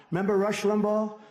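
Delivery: normal talking voice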